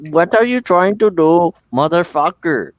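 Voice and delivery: loud, high mock Indian accent; irate Indian scammer. Scammer snaps at the scam baiter, loud mock-Indian outrage. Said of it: "one time the scammer goes".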